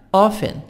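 "Often" said ɔfən, no T; silent T. Shown as a demonstration. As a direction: drop the T in "Often". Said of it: "T silent".

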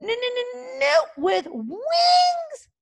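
high, slow, offended stutter; stammering dragon repeat. A narrator's reading. Toothless repeating the insult back to Snotlout, high stammer. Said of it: "repeated Toothless".

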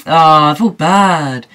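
ordinary talk

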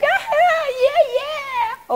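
very high, loud hyper squeal; mock giddy squeal. Showing viewers what she is not always like, high squeal. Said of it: "I'm not always like".

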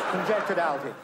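plain reading voice

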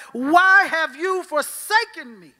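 slow, high, loud anguished cry of "Why have you forsaken me?". Acted. Jesus on the cross calling to God, a slow anguished cry. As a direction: call up to heaven in anguish. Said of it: "he cried out to God".

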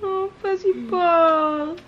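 slow, crying coo to a pet; tearful cooing. Real, not acted. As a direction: let the tears break through the coo. Tearful goodbye to the cat.

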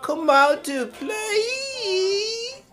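high, slow, sing-song taunting call; Warriors movie taunt. Retold or imitated. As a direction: drag it out like the gang leader calling them out. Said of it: "a dude clinking bottles together going".

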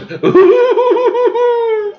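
high falsetto whoop; long quavering high hoot; her version. Retold or imitated. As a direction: imitate her with a squealed ooh. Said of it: "Or hers".

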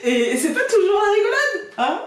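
phrase dite haut, à travers le rire; en riant. Real, not acted. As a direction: parle en riant encore. Dit en riant. (voix aiguë et rieuse)

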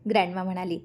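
ordinary delivery

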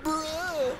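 very high, loud creature squeal; imitating little Ugnaughts. Voicing the Ugnaughts as Chewie comes in, squealed mimicry. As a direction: squeal it like tiny startled creatures. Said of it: "and they're like".

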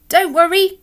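high, loud dolly voice; calling little dolly. A narrator's reading. Dolly calling to desperate Alan, reassuring call. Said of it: "called a little dolly".

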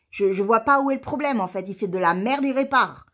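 loud, exasperated demand; angry rant. Real, not acted. Vlogger demanding the culprit repair the letterbox, angry. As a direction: lay down the law, loud and fed up. Ranting that culprit must fix it.

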